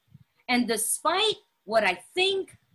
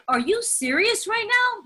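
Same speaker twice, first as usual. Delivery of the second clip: higher-pitched disbelief; incredulous; 7 semitones higher than usual. Retold or imitated. Speaker recalls reacting to the book's definition, incredulous. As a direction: demand it in mild outrage.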